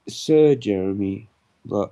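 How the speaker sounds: slow, loud, grand title; pompous sign reading. Pretend. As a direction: announce yourself like nobility. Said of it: "I'll sir myself".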